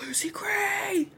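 breathy whispered call of her name, slow; retold scream done as a whisper; mock scream as a whisper. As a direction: whisper the name as if calling into the woods. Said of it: "just starts screaming for her".

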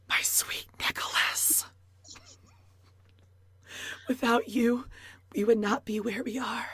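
a hushed, fake-intimate whisper; mock whisper. Podcaster mocking April's vows to Nick, in a whisper. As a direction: breathe the vows like a put-on secret.

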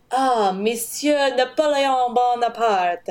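slow, exaggerated French accent; pretentious French pronunciation, overdone; hammed-up French accent. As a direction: imitate a showy French accent, drawn out. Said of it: "hilarious how he would be like".

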